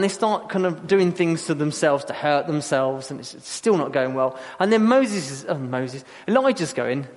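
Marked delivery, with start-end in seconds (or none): none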